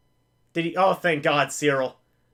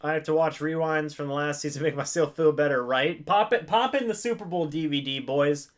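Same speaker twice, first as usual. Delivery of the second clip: normal talking voice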